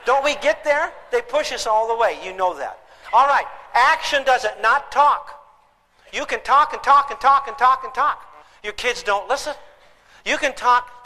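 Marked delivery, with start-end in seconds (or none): none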